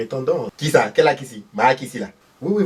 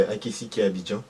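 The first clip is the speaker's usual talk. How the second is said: ordinary talk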